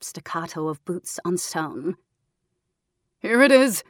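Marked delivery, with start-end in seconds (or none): 3.2-3.8 a raised soldier's call, louder and higher; soldier finding the spring. A narrator's reading. Soldier calls to his squad on finding the spring, loud. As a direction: announce the find across the cave. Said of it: "a voice said".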